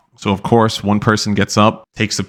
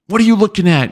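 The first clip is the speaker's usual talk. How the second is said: much higher, louder accusing bark; instructor yell; 11 semitones higher, 3 dB louder than usual. Retold or imitated. Drill instructor yelling at a recruit who looked around.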